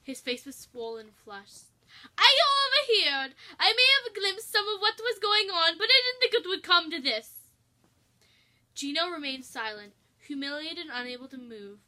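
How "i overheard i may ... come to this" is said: high, loud fussing chef; a worried cook raising his voice high; fretful chef, pitched well up and loud. Acted.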